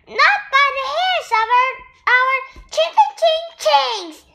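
high, fast squeaky piggy refusal; tiny pigs standing their ground; defiant little pigs. A narrator's reading. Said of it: "shouted the little pigs".

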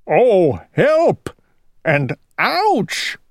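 high-pitched yelp for help; storybook cry of pain and distress. A narrator's reading. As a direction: wail it out like a fussing old king. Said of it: "cried the king".

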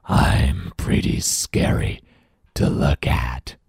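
very slow, low monster rasp; big creature trying to scare; deep, scary monster. Acted. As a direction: stretch each word in a menacing growl. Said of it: "scary voice".

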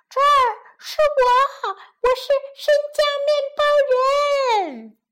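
high little creature calling out; gingerbread man voice. A narrator's reading. Gingerbread man calls from the oven, high creature voice. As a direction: pitch it high, announce yourself. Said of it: "烤箱里有个声音说".

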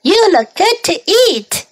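high character cry. A narrator's reading. Hungry cow eyes the gingerbread man, high-pitched cry. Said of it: "cried the cow".

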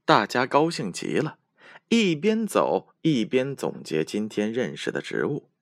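plain reading voice

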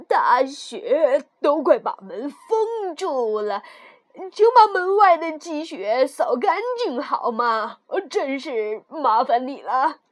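put-on slow, emphatic old dwarf. Dwarf politely asks the girl to sweep snow, slow dwarf voice. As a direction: ask slowly as a little dwarf.

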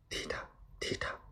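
very soft, voiceless footfall sounds; whispered boot steps. A narrator's reading. Narrator echoes steps behind the nervous emperor, eerie whisper. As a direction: whisper the steps like a haunting echo.

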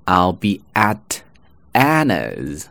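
"at" standing apart before Anna's; slow, separate words with a clear t in "at"; full form. Shown as a demonstration. Teacher setting up the Anna example, unlinked full "at". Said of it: "前面读为".

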